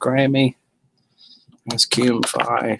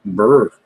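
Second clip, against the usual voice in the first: shivering brr; 6 dB louder than usual. Real, not acted. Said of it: "That is cold".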